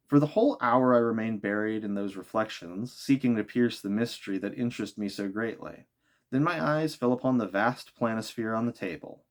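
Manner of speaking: ordinary delivery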